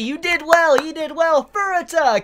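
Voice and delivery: high, loud cheer; mock cheering crowd. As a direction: cheer him on like the whole office is clapping. Office cheering and clapping Furuta.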